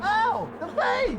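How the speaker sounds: mock panic. Audience member attacked by bees.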